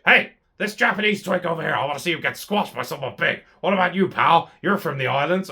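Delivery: barking orders as the big boss, hammed up a little; Vince McMahon. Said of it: "You can just see Vince McMahon backstage".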